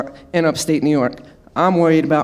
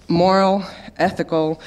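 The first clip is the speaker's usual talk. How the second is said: ordinary delivery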